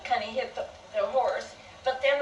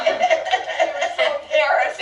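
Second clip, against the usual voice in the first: normal talking voice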